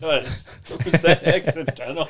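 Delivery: high, loud laughing question. Real, not acted. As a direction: ask it while cracking up. Cracking up at date slip.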